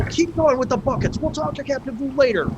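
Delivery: high-pitched mock yell of an irritated sailor; angry bickering. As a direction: play a crewman urgently arguing, voice pushed way up. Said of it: "so they're, like, angry".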